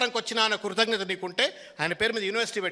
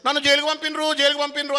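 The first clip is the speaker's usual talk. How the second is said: higher, sneering imitation of a complaining politician; mimicking the rival's self-pity in a higher voice; mocking whine; 6 semitones higher, 4 dB louder than usual. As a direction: parody him whining about being jailed, pitched up. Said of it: "అని చెప్పని".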